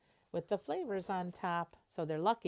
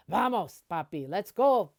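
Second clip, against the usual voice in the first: louder, eager cheering on; excited urging; 3 semitones higher, 11 dB louder than usual. Acted. Girl on the back urging Papi to go faster, excited loud. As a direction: egg him on, thrilled.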